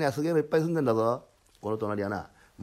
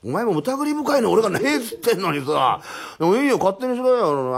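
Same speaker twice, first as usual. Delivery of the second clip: loud, high-pitched exasperation; exasperated retort; 8 semitones higher, 6 dB louder than usual. Acted. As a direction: protest at being doubted. 疑われた住人が言い返す. (あきれて声高に言い返す)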